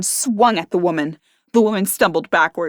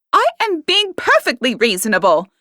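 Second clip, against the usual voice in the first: higher, louder retort; shout back; 7 semitones higher, 4 dB louder than usual. A narrator's reading. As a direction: shout it back while shoving. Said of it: "Henrietta shouted back".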